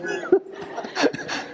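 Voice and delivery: high unvoiced laugh; high breathy laugh. Real, not acted. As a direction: laugh at his own shame. Laughs at his own story.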